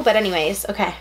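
ordinary talk

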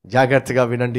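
normal talking voice

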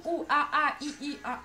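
high, silly monkey-like vowel sounds mimicking the insult; mock monkey noises. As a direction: imitate the jungle sounds they expected, half laughing. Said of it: "je l'ai dit".

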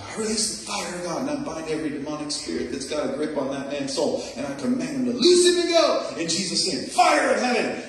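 shouted prayer. Retold or imitated. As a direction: rise into a full preacher's shout by the end. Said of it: "I shouted it out in the car".

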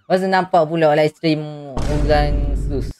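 words spilling through a laugh. Real, not acted. Streamer laughing at a viewer's slip, amused.

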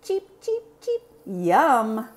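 high peeping, pleased yum; a chick tasting something good; happy chick. Acted. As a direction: Carlos cheeping with delight. Carlos as chick likes lunch.

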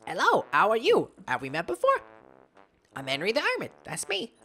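put-on high, rustic old hermit. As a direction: voice the hermit with a country twang. Voices the hermit character.

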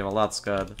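ordinary delivery

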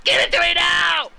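high, loud bratty demand; shouting demand. Acted. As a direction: yell it like a brat. Hero demands Pokemon.

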